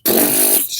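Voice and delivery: loud, low sputtering gun noise; imitated gunfire. Gunner spraying a village, imitated machine-gun burst. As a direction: sputter a burst of bullets with the lips.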